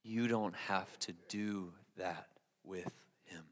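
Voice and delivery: very soft, slow and low; slow right down, hushed and sincere; gentle reassurance. Real, not acted. Preacher reassures congregation about honest prayer, hushed and slow.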